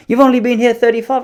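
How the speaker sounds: high, cheeky comeback; mock vendor tease. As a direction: toss it back with a smirk. Vendor teasing back.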